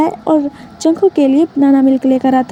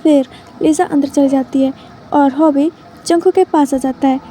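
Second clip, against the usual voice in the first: ordinary delivery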